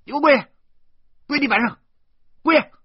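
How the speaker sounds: high, forceful bursts; angry, punched-out commands; loud commanding shout. Acted. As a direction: bellow orders at the child. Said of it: "我又大声地说".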